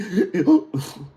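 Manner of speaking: high gasp as breath returns; acted recovering gasp. Sailor popped on the chest by chief, gasping. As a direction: suck air back in suddenly. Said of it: "I get my breath back".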